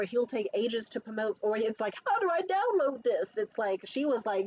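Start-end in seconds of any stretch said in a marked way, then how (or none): 2.1-3.4 high clueless voice; clueless mock. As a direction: play dumb, pitched up. Mocking clueless guy.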